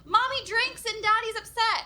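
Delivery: put-on high, innocent little kid voice; blurting kid. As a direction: blurt it like a cheerful five-year-old.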